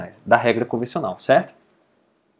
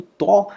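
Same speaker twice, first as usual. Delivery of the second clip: TÓ higher, strong and open; stressed syllable; 7 semitones higher, 4 dB louder than usual. Shown as a demonstration. Teacher isolating the strong syllable, "tó" stressed. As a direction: punch out "tó" with an open vowel. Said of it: "mais forte".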